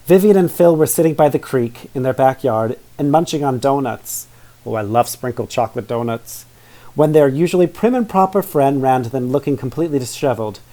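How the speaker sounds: ordinary delivery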